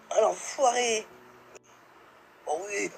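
ordinary delivery

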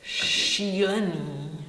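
slow Czech word demo. Shown as a demonstration. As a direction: draw Šílený out slowly, š up front. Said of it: "means crazy".

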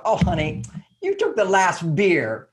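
teasing, light, pitch rising high at the end; playful mock complaint. Shown as a demonstration. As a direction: tease him gently about the beer. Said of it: "there would be this lightness to it".

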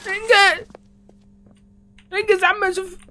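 high, loud hurry-up yell; high frustrated shout; impatient shout. Real, not acted. Impatient yelling at game.

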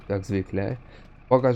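normal talking voice

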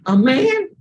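normal talking voice

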